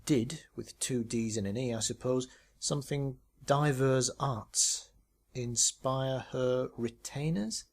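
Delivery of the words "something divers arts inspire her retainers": words spelled out slowly; reading worn letters aloud; slow deciphering. Acted. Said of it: "tried to decipher".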